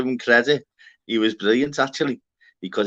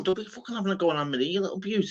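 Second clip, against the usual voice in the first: retold snap, higher and fast; mock indignant; 7 semitones higher, 3 dB quieter, faster than usual. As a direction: rattle off a mock defence. Retold defending Anne Marie.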